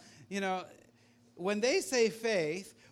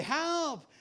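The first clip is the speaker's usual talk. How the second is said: high-pitched acted call for help; cry for help; 11 semitones higher than usual. Climber at the ledge's end, mock panicked cry for help. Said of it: "you cry out and you say".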